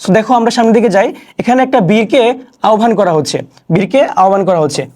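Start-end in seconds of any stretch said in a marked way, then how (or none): none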